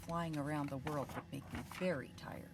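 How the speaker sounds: quick soft whisper. Acted. Reader whispering a quick aside to the children. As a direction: confide to the kids.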